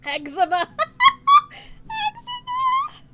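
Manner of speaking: very high and loud, slow; shrieked name. Real, not acted. Streamer squealing a silly baby name.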